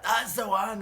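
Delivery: high, loud approval; loud cheer. Retold or imitated. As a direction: roar it from the bar. Bully cheers.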